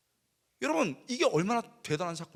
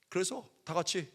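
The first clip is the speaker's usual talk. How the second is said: normal talking voice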